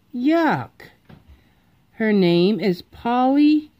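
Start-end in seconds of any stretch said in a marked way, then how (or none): 0.1-0.9 high put-on yuck; disgusted. A narrator's reading. Froggy reacting to his new sister, disgusted. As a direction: spit the word out in disgust.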